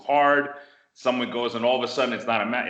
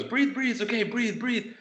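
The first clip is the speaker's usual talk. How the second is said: high-pitched, repeated urging; retold urgent coaching; 14 semitones higher than usual. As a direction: imitate someone coaxing a winded student through it. Retold teacher helping winded student breathe.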